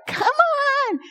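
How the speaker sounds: squeaky high exasperated Come on, way up in pitch; impatient groan. Retold or imitated. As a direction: play the fed-up brother stuck in a slow line. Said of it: "He gets so frustrated in the grocery store".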